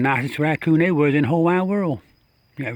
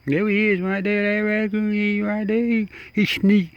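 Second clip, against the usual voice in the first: excited; 8 semitones higher than usual. Real, not acted. Spotting raccoon sneaking back.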